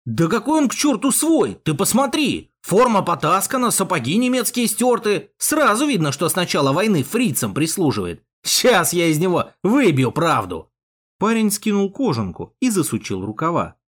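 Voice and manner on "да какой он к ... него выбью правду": high-pitched, heated outburst at a suspect; angry accusation. Acted. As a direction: accuse him hotly, voice pitched up. Henchman angrily accusing the captive.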